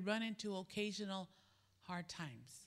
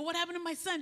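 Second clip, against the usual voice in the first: alarmed retelling; 9 semitones higher, 4 dB louder, much faster than usual. Retold or imitated. Mother hears son caused trouble.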